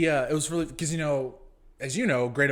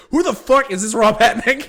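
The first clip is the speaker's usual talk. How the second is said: higher, louder mock yell; mock angry yell; 11 semitones higher, 15 dB louder than usual. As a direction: bellow it like an annoyed gamer. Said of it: "I heard Jace go".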